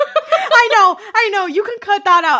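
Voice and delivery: loud, laughing, excited squeal. Real, not acted.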